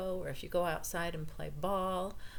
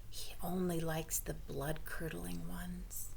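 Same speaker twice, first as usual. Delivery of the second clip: whispered; 6 dB quieter than usual. Acted. Mother confides about son.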